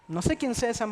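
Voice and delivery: ordinary talk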